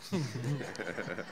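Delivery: brief, low, quiet chuckle; soft laugh. Real, not acted. Reader to audience, nothing said yet, soft amused laugh. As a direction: chuckle softly to yourself.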